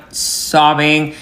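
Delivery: ordinary talk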